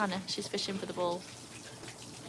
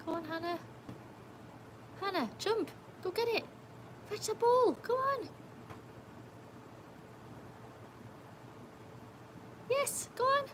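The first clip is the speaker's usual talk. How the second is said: much higher, rising coax; dog-urging voice; 13 semitones higher, 4 dB quieter than usual. Real, not acted. Owner urging Hannah to jump into the pool, high coaxing.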